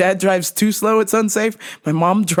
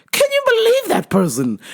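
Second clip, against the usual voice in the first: outraged mom; 13 semitones higher than usual. Retold or imitated.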